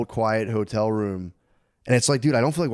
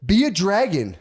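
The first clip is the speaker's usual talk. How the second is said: higher mock kid voice; 9 semitones higher, 4 dB louder than usual. Dad imitating his kids asking for the dragon filter. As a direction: ask like an eager small child. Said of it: "I call 'em like".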